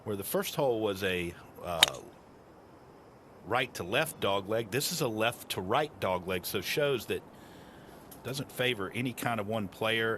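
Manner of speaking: ordinary talk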